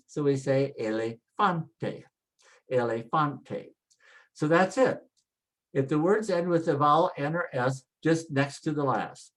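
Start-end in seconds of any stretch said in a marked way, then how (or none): 0.8-3.7 elefante with a leaned-on FAN; stress on FAN. Shown as a demonstration. Teacher models the rule, stressing elefante on FAN. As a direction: say elefante, weight on the third syllable. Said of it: "next to the last is fan".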